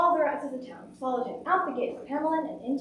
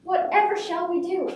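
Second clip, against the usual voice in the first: higher, louder theatrical lament; crying out; 6 semitones higher, 5 dB louder than usual. A narrator's reading. Townspeople helpless before the rats, theatrical cry of distress. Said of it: "they cried".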